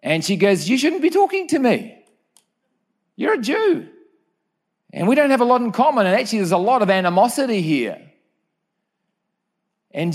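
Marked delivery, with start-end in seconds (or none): 0.7-3.9 high, far-off voice of the woman at the well; Samaritan woman. Retold or imitated. 4.9-8.0 quick, slightly raised protest; hurried woman. Retold or imitated. Samaritan woman voiced in story.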